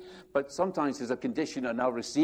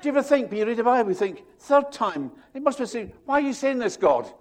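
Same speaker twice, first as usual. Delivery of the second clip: mock scared; 10 semitones higher, 6 dB louder than usual.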